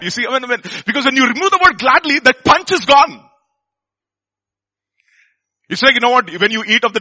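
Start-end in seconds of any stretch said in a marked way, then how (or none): 0.9-3.2 loud, higher, slowing on punch is gone; emphatic preaching. Real, not acted. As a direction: drive the point home. Preacher stresses lost punch.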